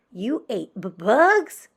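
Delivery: stammered shock rising high; stuttering. Acted. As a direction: stutter then squeak out bugs in disbelief. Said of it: "stuttered one".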